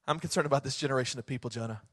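God gently answering whiny Jonah; quiet God. Acted. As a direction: answer softly, almost under the breath.

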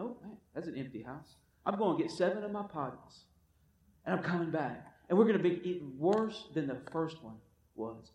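low, soft, sly demon voice; scheming demon. Retold or imitated. The returning demon eyeing the empty house in a low, soft plot. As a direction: mutter like a demon plotting his return. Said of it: "He says".

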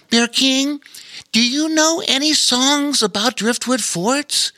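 put-on high boyish question; young boy voice. A narrator's reading. Lore asking Bear King for a song, high boyish voice. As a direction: voice Lore with a hidden plan.

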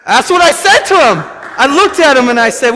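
high, loud pulpit cry; loud preaching. Real, not acted. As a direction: preach it out hard. Preacher at full volume.

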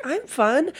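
squeaky high protest; high-pitched defensive insistence. Retold or imitated. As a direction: jump way up in pitch. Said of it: "high in her voice".

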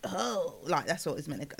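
a quiet, low imitation. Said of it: "that's how [name] talks".

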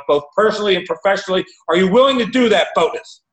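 urgent coach pitch building to a high, loud challenge; imitated supercharged coach, rising in pitch and force; Steve Hardison impersonation. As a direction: drive it forward and finish amped up. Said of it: "that's like a perfect impersonation of him".

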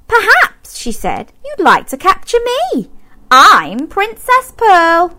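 high, bright princess voice; Princess Pearl's voice. A narrator's reading. Princess offering herself to Zog, high voice.

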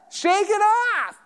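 high, slow breezy command; God answering a complainer, high and slow; voice of God, light. Retold or imitated. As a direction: say it high and slow, brushing it away. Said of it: "He's gonna say".

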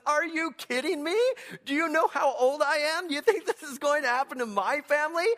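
high, loud cracked disbelief; incredulous old priest. Retold or imitated. Zechariah protesting to God that he is too old, high and loud. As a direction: squawk it out like a shocked old man. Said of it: "and he says".

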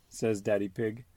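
ordinary delivery

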